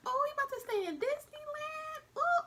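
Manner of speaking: very high, giddy squeal; mock excitement. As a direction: gush like a kid headed to a theme park. Acted excitement before the letdown.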